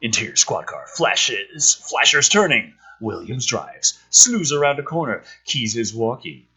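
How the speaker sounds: reading a screenplay aloud, a touch dramatic; lightly dramatic screenplay read; script reading. Acted. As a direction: read the scene headings and action like a narrator.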